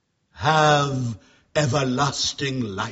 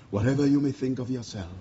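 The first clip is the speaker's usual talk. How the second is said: ordinary talk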